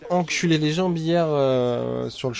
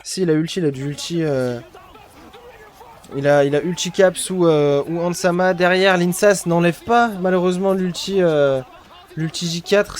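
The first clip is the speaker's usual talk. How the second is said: esports caster; 3 semitones higher, 6 dB louder than usual. Real, not acted. Live fight recap.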